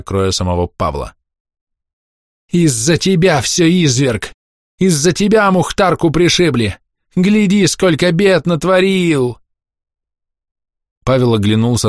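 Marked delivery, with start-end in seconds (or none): 2.5-9.4 high, scolding lament; wailing reproach. A narrator's reading. Old woman blames Pavel for her dog, wailing reproach. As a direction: berate him in a high tearful wail. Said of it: "сзади голосила бабка Матрёна".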